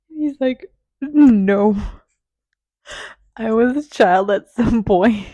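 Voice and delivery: loud retelling broken by laughter; laughing through a retold exchange; can't stop laughing. Real, not acted. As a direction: belt out the quotes while cracking up. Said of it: "I can't stop laughing".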